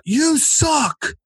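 high-pitched mock heckle; heckler's shout. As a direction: yell it from the back of the club. Audience member heckles him.

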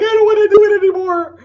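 high, loud whiny wail of giving up; imitating a fed-up client. Client in the hot seat wants to quit, whiny loud wail. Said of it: "Sometimes people are like".

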